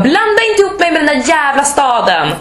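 high, hammed-up outrage; mock indignation. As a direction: retort as if deeply insulted, playing it up. Acted indignant reply to wrong guess.

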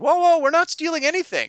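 high, loud, defensive protest; alarmed denial. Retold or imitated. Players caught in the cellar by the owner, alarmed denial. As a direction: back off fast, pitch shooting up. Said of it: "And they're like".

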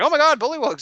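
high, loud mock alarm; alarmed exclamation. Party discovering bullywugs behind the passage, alarmed. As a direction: cry out at sudden monsters. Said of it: "and then the party's like".

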